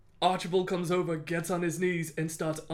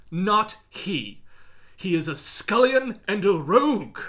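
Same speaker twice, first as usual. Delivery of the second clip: louder, rising mock-noble scorn; a hammed-up chronicle insult; archaic insult; 5 semitones higher, 8 dB louder than usual. Ramsay answers the guards, scornful archaic retort. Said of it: "Ramsay's retort is".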